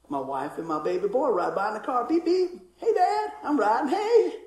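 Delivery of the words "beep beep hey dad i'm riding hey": high, cheery call from a passing car; high-pitched playful retold greeting; calling out happily. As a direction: imitate family hollering hello in passing. Family calling as they drive by.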